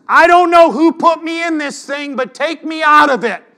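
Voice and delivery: loud, high shouted imitation of a fed-up texter; indignant outburst. Man quitting a group text, imitated indignant shout. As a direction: shout it out high, demanding to be removed.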